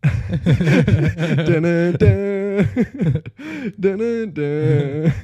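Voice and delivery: high, loud dramatic sting through laughter; giggly mock-ominous dun dun dun; laughing dun dun dun. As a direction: sing the suspense chord while cracking up.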